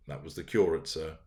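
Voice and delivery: usual speaking voice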